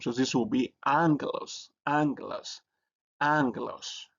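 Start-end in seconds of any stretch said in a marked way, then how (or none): 0.8-1.7 "Angelos" with the double gamma said as "ng-g"; NG sound in Angelos. Shown as a demonstration. Teacher showing Greek learners double gamma, "Angelos" said with NG. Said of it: "you pronounce it as N G sound". 1.9-4.1 "Angelos" repeated as a model, NG then hard G; NG sound in Angelos. Shown as a demonstration. Teacher repeating the word for Greek learners, "Angelos" said with NG. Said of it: "you pronounce it as N G sound".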